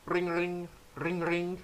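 soft trilled ringing; little sing-out ring-ring; imitated phone ring. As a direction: mimic a telephone ringing, softly.

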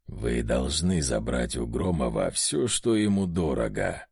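низкий скрежещущий демонический голос; проскрежещи низко и медленно. A narrator's reading. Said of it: "проскрежетал одержимый". (скрежет одержимого, низко)

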